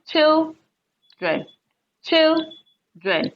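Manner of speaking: put-on children with CHIL high and drawn out; stress on first syllable. Shown as a demonstration. Teacher repeating children for the class, first syllable stressed. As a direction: lean long on CHIL, let dren fall away. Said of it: "pronounced, you know, louder and it is longer".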